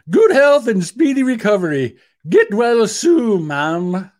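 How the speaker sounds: hammed-up British well-wisher; horrible British accent. Opposition leader wishing the monarch well, in a mock British accent. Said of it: "British accent".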